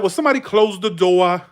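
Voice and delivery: dropped-R Northern call; Massachusetts accent. Retold or imitated. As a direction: imitate a shop worker's thick Massachusetts accent. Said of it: "that fucking strong".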